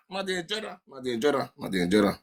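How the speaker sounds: fading repetition. Pretend. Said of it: "repeated phrase fading away".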